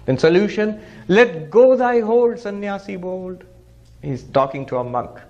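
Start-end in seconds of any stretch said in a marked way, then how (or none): 1.1-3.4 slow, higher, louder on let go; declaimed verse. Acted. Swami declaiming the poem's call to the monk, slow and higher. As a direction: proclaim the verse's command. Recites poem refrain.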